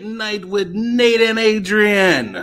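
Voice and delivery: high, slow, drawn-out announcer call; stretched-out late-night intro; show announcer. Acted.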